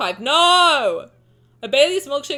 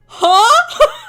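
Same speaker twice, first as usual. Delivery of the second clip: high, loud, startled; baffled huh; 13 semitones higher, 10 dB louder than usual. Real, not acted. Streamer baffled by wine descriptions, loud huh. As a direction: fake total confusion.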